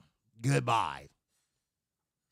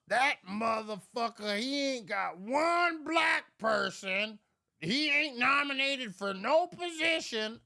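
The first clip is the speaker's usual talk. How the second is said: higher mock shouting uncle; imitating an angry uncle, raised voice; uncle's rant; 7 semitones higher than usual. Retelling uncle's complaint about Trump, mock yell. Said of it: "he talking about".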